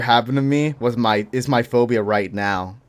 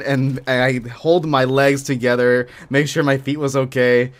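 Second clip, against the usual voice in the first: usual speaking voice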